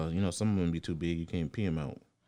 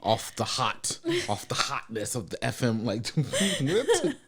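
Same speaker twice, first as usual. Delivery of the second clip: higher, louder teasing echo breaking into laughter; laughing mockery; 6 semitones higher, 6 dB louder, slower than usual. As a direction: mimic the slip and crack up laughing. Mockingly repeats partner's slip, laughing.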